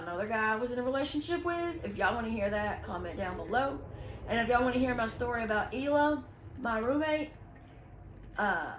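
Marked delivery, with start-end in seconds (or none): none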